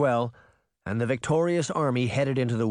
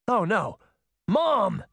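much higher, louder alarmed cry; cried out; 9 semitones higher, 3 dB louder, slower than usual. A narrator's reading. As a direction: panic on seeing his mother trapped. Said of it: "Mordred cried".

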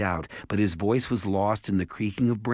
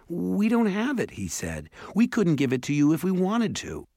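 put-on much higher, pleading boy; urgent higher protest, faster at the end; 11 semitones higher, 3 dB louder than usual. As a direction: insist you're innocent, voice up high. Pleading innocence with elves.

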